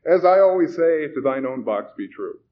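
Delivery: high, loud start, settling down; brightly. A narrator's reading. Host covering up after the man fled, brightly. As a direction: sail on cheerily with a motto. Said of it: "said the young man brightly".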